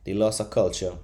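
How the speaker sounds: ordinary talk, nothing marked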